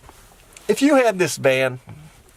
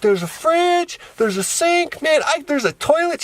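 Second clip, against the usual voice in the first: amazed niece; 12 semitones higher than usual. Retold or imitated. Niece finding each feature of the van, amazed. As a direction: imitate a child wowed by each new find.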